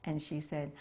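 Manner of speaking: ordinary talk, nothing marked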